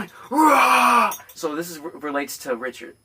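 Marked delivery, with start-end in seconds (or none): 0.3-1.1 high-pitched Wolverine roar; screaming roar. Retold or imitated. Comic re-enacting his Wolverine audition, screaming roar. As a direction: roar like a raging animal. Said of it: "I'm like screaming".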